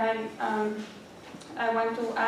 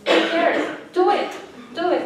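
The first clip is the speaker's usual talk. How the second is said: louder, higher rallying cry; emphatic urging; 5 semitones higher, 8 dB louder than usual. Real, not acted. Student speaker urging classmates not to fear mistakes, emphatic. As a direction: punch out each short exhortation.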